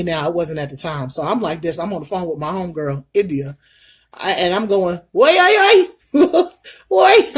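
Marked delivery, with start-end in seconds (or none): 5.1-7.4 high, loud, frantic repeated wait; panicked cry. Retold or imitated. Woman falling from her chair yells wait to her friend in a panic.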